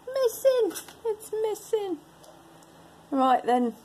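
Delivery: high-pitched English accent; put-on English accent. Real, not acted. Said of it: "why I have an English ac".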